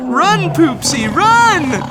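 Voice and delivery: high, loud cry to run; urgent shout. Acted. Narrator urges cat fleeing tigers, loud high shout. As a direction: yell her to safety.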